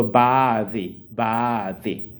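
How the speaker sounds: "baadhi", slow, th clearly heard. Shown as a demonstration. Teacher wearing a mask, modeling the word slowly. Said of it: "see how I'm pronouncing".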